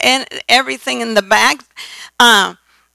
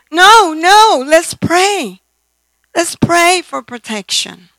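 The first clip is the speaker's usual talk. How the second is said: a touch higher, eager urging to pray; a little boy pleading to pray first; child urging; 7 semitones higher, slower than usual. Retold or imitated. Grandson urging the family to pray, lightly voiced eager child. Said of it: "Giovanni say".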